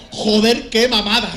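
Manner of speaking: loud, high excited exclamation; rabbit exclaiming. Acted. Rabbit exclaims at the punchline, loud and excited. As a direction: belt the punchline out.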